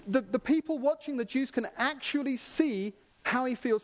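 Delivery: normal talking voice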